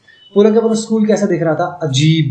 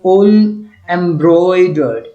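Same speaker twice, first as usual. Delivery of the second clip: slow reading aloud; 4 dB louder, much slower than usual. Acted. Teacher reads English text aloud.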